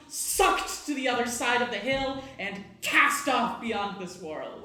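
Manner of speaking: loud, sweeping scene-setting; big, projected storyteller voice; dramatic game master narration. Acted. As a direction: narrate a magical climax with force.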